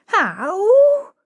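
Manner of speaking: high, loud rising wail; bewildered howl. A narrator's reading. Flower girl ordered to sit, bewildered howl. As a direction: wail up high, half rebellious. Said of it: "half rebellious, half bewildered".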